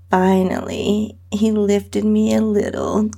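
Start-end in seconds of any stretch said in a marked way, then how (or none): none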